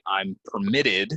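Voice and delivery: "permitted" stressed as per-MIT-ted; second-syllable stress. Shown as a demonstration. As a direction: slowly lean on MIT in "permitted". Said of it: "stress goes here".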